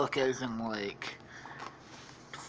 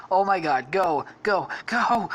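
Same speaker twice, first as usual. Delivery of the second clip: acted panic; 5 semitones higher, 5 dB louder than usual. Parents urging each other to flee.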